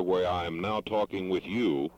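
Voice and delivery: normal talking voice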